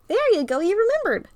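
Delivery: high, loud, delighted Carl voice; bright friend celebrating a small win; excited cheer. Acted. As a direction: cheer him on as he finally wakes.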